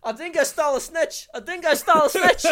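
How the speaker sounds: high, loud mock Australian shriek; over-the-top Australian wail; Australian accent. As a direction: shout it in a hammed-up Aussie accent, cracking up. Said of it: "authentic Australian accent".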